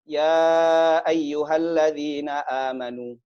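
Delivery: slow, drawn-out chanted recitation; slow melodic Quran chant. Acted. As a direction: recite the verse slowly, melodic.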